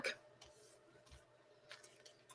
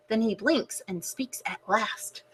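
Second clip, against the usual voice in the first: hushed storybook whisper; 2 semitones lower, 12 dB quieter than usual. Acted. Reader whispering to the kids before the big reveal. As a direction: whisper it to build suspense. Suspense before fish speaks.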